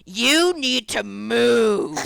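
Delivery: very slow, drawn-out mock demand; hammed-up slow anger; imitated angry kid. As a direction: stretch every word like a menacing blockhead. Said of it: "angry Minecraft Steve looking motherfucker".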